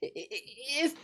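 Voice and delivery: stammering, trembling plea; a scared boy barely getting the words out. A narrator's reading. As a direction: Edmund stammers to the wolf, trembling. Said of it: "trembling so that he could barely speak".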